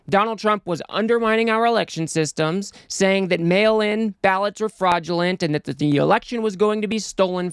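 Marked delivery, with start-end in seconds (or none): none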